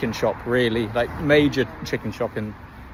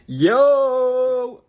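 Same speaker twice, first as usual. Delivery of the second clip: loud excited greeting; high excited shout; excited yell; 12 semitones higher, 6 dB louder than usual. Real, not acted.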